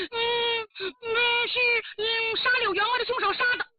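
high, halting excuse; nervous stalling. Acted. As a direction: stumble through a cover story in a high voice. Frightened maid invents an answer. (心虚地高声支吾)

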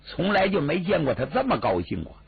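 usual speaking voice

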